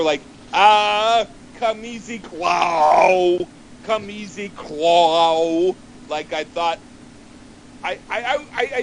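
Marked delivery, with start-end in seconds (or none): 0.5-5.7 singing come easy Claw with Claire turned into "Claw"; mispronounced lyric. Retold or imitated. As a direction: mimic the singer's slurred "Claw". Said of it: "mispronounced Claire".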